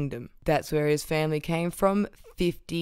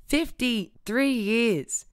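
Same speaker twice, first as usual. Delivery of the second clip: Fifty-three years, drawn out slowly and higher; slow emphatic; 5 semitones higher, much slower than usual. Real, not acted. Indignant repeat of deportee's years.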